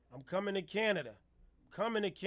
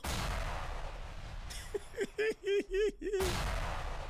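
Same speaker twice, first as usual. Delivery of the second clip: high breathless laugh; airy cackle; high wheezy laugh; 11 semitones higher, 5 dB quieter than usual. Real, not acted. Laughs at his own joke.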